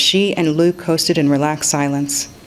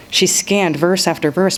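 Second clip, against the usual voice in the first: usual speaking voice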